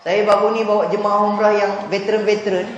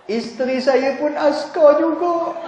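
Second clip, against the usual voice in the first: whining husband; 6 semitones higher, slower than usual. Retold or imitated. To the ustaz, the husband whines about his problem. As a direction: plead like a man close to tears. Husband says wife is soldier.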